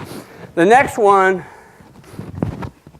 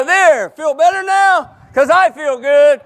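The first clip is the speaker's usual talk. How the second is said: smug self-satisfied; 7 semitones higher than usual. Retold or imitated. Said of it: "And I go".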